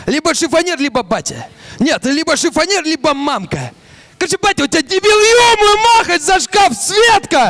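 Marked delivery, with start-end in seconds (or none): 4.2-7.5 excited rant. Acted. Father rants higher.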